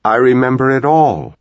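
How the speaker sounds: high, loud, insistent. A narrator's reading. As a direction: press all of it out. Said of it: "in a suddenly loud voice".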